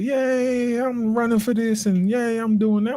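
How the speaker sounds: high-pitched fake enthusiasm; mock cheering. Mocking uninspiring schoolmates on Facebook, high fake cheer.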